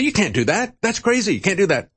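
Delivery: high-pitched, imitated alarm; mock outraged protest. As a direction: object like a scandalized critic, pitched well up. Acting out critics' alarmed objection.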